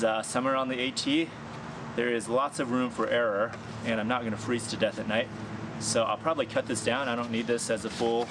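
normal talking voice

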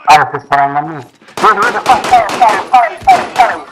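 urgent, rising yells; excited shouting. Real, not acted. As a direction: urge your teammate to fire, fast. Firefight, urging teammate to shoot. (grida concitate)

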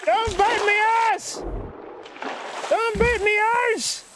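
high, drawn-out Irish yell; mock-panicked Irishman, stretched and high; Irish accent. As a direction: cry out in a hammed-up Irish brogue. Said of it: "speaking like an Irishman".

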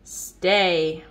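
deep stern stay; low firm command. Acted. Owner's command to dog.

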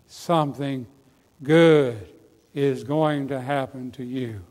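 a hint of the TV evangelist's slow blessing; low, drawn-out closing promise with pauses; Oral Roberts. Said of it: "Do like Oral Roberts used to say".

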